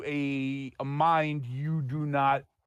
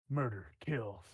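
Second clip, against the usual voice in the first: fiendish murmur; slower than usual. Acted. GM voices the fiend's thoughts.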